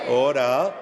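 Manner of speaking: loud sharp call to order; shouting order. Real, not acted. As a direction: bark it over the noise. Speaker calls chamber to order.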